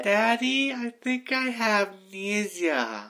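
put-on high, slow little kid voice; four-year-old voice. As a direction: play a small child asking daddy. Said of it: "like a four-year-old trying to pronounce".

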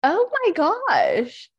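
high, drawn-out shocked gasp of words; stunned. Real, not acted. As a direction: stretch it out in disbelief. Shock at bait and switch.

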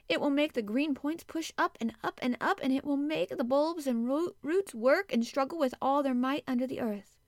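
high, loud rush of excitement; eager delight. A narrator's reading. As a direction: build it with bouncing, emphatic energy. Girl delighted by spring.

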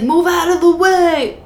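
high, drawn-out Jersey driver's gripe; mock New Jersey road complaint; New Jersey accent. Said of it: "have, like, a little accent".

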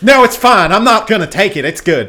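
high, loud mock polite refusal; mock woman refusing. Retelling the movie-theater neighbor's high fussy refusal. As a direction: squeal the refusal like a fussy lady. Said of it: "she said".

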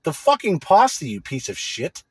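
hammed-up indignant comeback at the phone clerk; retold angry retort. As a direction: snap back in disbelief, pitch climbing. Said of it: "And it's like".

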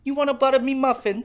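flirty mock English falsetto; terrible English accent. Teasing his friend in a hammed-up English accent. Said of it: "our terrible English accents".

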